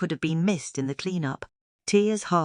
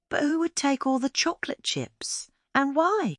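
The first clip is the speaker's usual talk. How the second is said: high, wondering questions; a girl baffled by the stash; puzzled wonder; 10 semitones higher than usual. Acted. Narrator voicing Tia's wonder.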